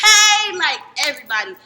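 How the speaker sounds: high, shouted hello; acted-out over-eager call; loud greeting. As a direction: holler it to the whole office. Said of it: "he introduced me to everybody".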